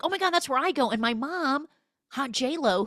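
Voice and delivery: high, loud excited gush; exaggerated high-pitched enthusiasm; mock excited teen. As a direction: blurt it out like a thrilled kid. Voicing excited teen son.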